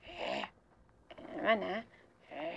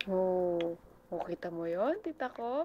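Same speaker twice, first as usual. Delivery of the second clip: ordinary talk, nothing marked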